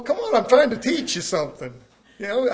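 normal talking voice

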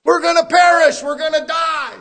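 terrified yelling in the storm; panicked shout. Retold or imitated. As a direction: shout like men sure they'll drown.

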